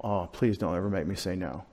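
soft, low begging aside; mock pleading. Preacher pleading with the congregation, softly and mock-desperately. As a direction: plead quietly, half joking. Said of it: "If you make me say no".

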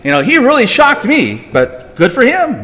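mock surprise. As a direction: play at being impressed and taken aback. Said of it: "Was God surprised by Abraham's faith?".

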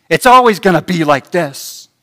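loud, whiny complaining voice; grumbling lie. Retold or imitated. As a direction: play the self-pitying grumbler. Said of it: "Lies like this".

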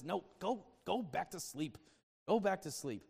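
put-on tired old Eli. Eli sends Samuel back.

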